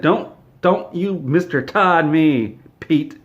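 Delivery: slow, emphatic mock scolding; indignant rebuke. Todd corrects Pete for calling him Mister, indignant. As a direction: pull the underling up short, word by word.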